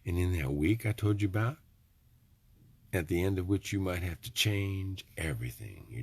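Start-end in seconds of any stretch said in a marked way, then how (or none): none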